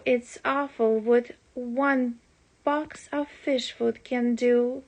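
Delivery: plain reading voice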